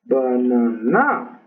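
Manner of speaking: "banana" slowly as ba-na-NA; slow "banana" peaking on the final NA; stress on third syllable. Shown as a demonstration. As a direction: start low, then lift and stretch the last "na" of "banana". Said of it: "Y en la tercera".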